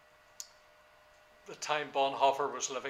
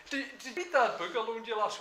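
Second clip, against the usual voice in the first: casual coffee chat; 7 semitones higher, 3 dB louder than usual. Retold or imitated. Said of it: "that's the way we talk".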